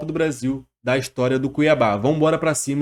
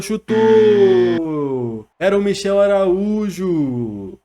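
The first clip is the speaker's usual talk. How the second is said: explosive goal commentary; 2 semitones higher, 4 dB louder, much slower than usual. Real, not acted. Opponent goal, drawn out.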